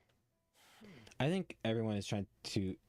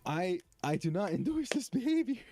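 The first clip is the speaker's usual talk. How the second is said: squeaky disclaimer; 10 semitones higher, 4 dB louder than usual. Real, not acted. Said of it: "repeats disclaimer".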